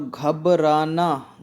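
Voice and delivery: "घबराना" stretched out slowly with r, ghab-rā-nā; very slow "घबराना", long rā and nā; correct pronunciation. Shown as a demonstration. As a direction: say "घबराना" syllable by syllable with a clear r. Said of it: "घबराना होता है".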